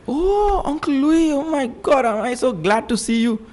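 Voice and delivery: put-on high, excited child's relief; high delighted childlike cry; relieved child. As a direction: gush with relief like a small boy. Boy relieved to see uncle.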